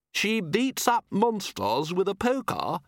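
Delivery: amused posh guest voice. Acted.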